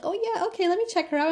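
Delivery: high, airy-casual imitation; mock office worker. Office staff promising to check her out, mock-agreeable.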